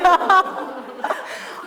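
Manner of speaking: high airy laugh breaking out; burst of laughter. Real, not acted. Teacher cracking up at the story, airy laugh.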